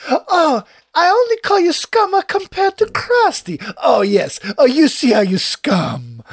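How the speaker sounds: hammed-up Italian, sweetly insulting; mock Italian accent. Quoting the waiter to the other diners, high mock Italian accent. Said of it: "they're like".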